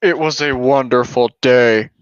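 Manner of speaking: a hint of menace under the words; lightly ominous kid voice. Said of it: "in a somewhat ominous voice".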